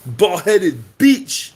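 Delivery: put-on slow, loud drawn-out Russian-accent insult. Boxer taunting Fury in a Russian accent. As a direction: drag out each word in a Russian accent. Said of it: "that accent is a hundred percent sounds more Russian".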